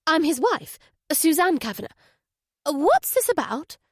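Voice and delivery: a well-spoken wife trying to sound composed; flustered wife. A narrator's reading. Wife answering the detective, flustered and guarded. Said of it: "the voice sounded flustered now".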